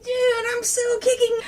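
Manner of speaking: high, whooping boast; excited Mikey. Retold or imitated. Mikey in the background of a big fight, high excited boast. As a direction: crow it way up high. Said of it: "Mikey's in the background going like".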